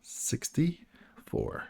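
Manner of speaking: slow whispered number call; whispered bingo call. Pretend. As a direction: breathe the number out slowly. Echoing drawn number, whispered.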